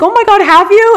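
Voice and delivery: very high, loud gasp of sympathy; shocked sympathy. Retold or imitated. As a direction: driver gasps at the bad hotel story. Driver reacts in shock.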